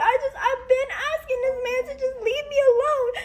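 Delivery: talking through real tears; real crying. Real, not acted. Breaks down telling of abuse.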